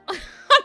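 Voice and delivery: squealing laugh; real laugh of disbelief; loud high laugh. Real, not acted. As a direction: burst out laughing. Laugh burst.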